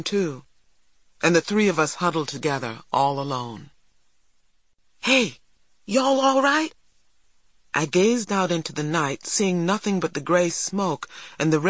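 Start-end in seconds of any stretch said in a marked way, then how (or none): 5.0-6.7 put-on high boyish call from a distance. A narrator's reading. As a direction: call over to check on friends. Neighbour boy calls from the lawn.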